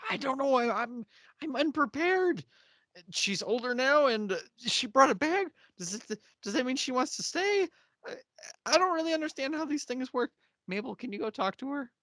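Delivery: high, rambling, unprepared character voice; hammed-up helpless fretting; imitated flustered man. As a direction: dither in a high voice, out of your depth. Acts out flustered character in dialogue.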